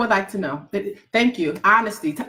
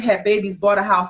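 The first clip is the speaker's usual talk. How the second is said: ordinary talk, nothing marked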